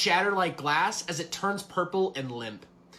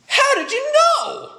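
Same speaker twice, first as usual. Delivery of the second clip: much higher, louder, slow fake gasp; mock surprise; 10 dB louder, slower than usual. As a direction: ham up the astonishment. Mock surprise at card shop.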